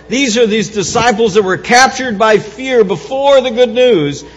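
loud, fervent pulpit preaching; rising Easter sermon fervour; preaching at full force. Acted. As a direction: drive it home to the congregation. Preaching Acts passage.

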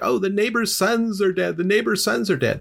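hammed-up panicked cry at normal volume; mock screaming panic. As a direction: imitate parents crying out dreadful news. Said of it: "they're screaming because".

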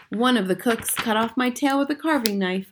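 ordinary delivery, nothing marked

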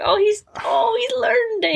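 put-on high, doting baby-talk coo. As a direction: coo it like a proud parent. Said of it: "It was like".

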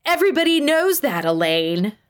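high, heated start dropping on the name; indignant retort. A narrator's reading. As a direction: fire back as if it's obvious. Acted yelling match continues.

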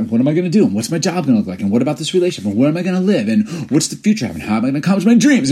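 questions tumbling out, pitch climbing; mock fretting. Podcaster voicing people anxious about the future, building mock panic.

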